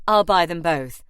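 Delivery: loud, abrupt offer; interrupting. A narrator's reading. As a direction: cut in firmly over the quarrel. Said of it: "Harry interrupted".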